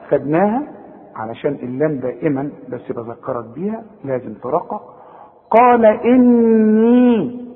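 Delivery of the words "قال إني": drawn-out, higher chanting tone on "قال إني"; Quranic recitation with ghunna. Shown as a demonstration. Teacher models stopping on the verse, "إني" hummed and stretched. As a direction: recite "قال إني" with the noon of "إني" hummed and the final ي held long. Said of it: "بغن النون حركتين".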